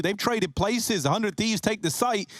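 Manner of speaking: rising, excited clutch play-by-play; esports caster. Real, not acted. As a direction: call the clutch with building hype.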